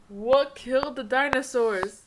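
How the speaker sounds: put-on slow setup, loud Arnold-accented punchline; hammed-up Arnold accent, slowly paced; Arnold Schwarzenegger. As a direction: build to a booming mock-Austrian villain pun. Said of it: "the way he talks".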